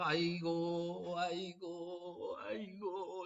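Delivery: slow, drawn-out mock keening; slow, fading mock lament at a funeral; mourning wail. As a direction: stretch each 아이고 like a mourner at a wake. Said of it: "이렇게 하고 있는데".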